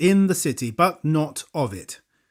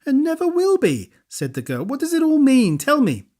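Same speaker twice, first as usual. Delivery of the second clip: put-on amused girl; 10 semitones higher than usual. A narrator's reading. Girl's voice.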